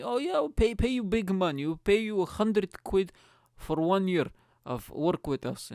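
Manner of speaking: high, eager Eastern European dealmaker; Eastern European accent. Acted. Primark rep promising big money, eager mock Eastern European accent. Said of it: "they're gonna have an Eastern European accent".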